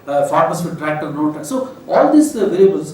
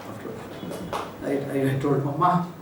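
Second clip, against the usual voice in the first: normal talking voice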